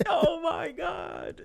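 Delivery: high, slow wailing scream; hammed-up terrified yell; mock screaming panic. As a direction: wail it like a panicked soldier. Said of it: "he's like".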